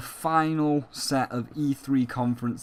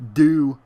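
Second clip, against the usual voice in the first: "do" punched much louder; emphatic do; 2 semitones higher, 10 dB louder than usual. Real, not acted. Confessing affection to Bubsy, loud emphatic "do". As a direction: hit "do" hard and sincere. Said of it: "I genuinely".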